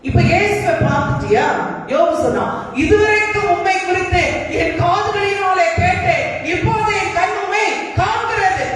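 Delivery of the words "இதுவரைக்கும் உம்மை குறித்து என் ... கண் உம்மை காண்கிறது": high, ringing scriptural proclamation; impassioned preacher quoting scripture; fervent declaration. Acted. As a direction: declaim it to God at a high pitch. Acting Job's declaration to God.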